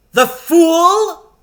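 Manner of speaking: high, loud shrieking witch; witch's cry. A narrator's reading. Witch crying out at the captured lion, shrill and loud. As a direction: shriek it out in triumph. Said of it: "she cried".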